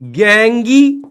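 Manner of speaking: गैंगी drawn out very slowly; slow gang-gee with a long ee; supposed logical pronunciation. Shown as a demonstration. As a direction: stretch gang into two syllables, गैंगी. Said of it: "ऐसे होना चाहिए था ना".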